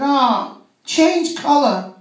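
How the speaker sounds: slow, high mock Chinese accent; hammed-up Chinese English, drawn out and high; a chip shop owner quizzing a customer in a slow, high mock Chinese accent.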